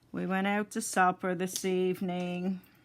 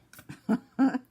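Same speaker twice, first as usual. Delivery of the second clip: short amused chuckle; a quick bright laugh; light laugh; 6 semitones higher, 8 dB louder than usual. Real, not acted. Laughs after joke about warmth.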